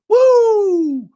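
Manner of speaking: loud, high, sustained "woo"; long high-pitched cheer; mock excited whoop. Mock excitement at small gains.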